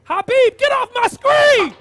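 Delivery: high, very loud angry shout; exasperated yell. Acted. Pastor ordering Habib off his screen, exasperated yell. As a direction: yell him off, stretching the words.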